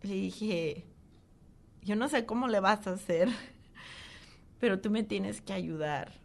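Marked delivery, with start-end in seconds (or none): 1.8-6.2 measured, higher-pitched demand to God; retold firm plea. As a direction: insist on help, emotion in the voice. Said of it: "se lo dije con mucha firmeza".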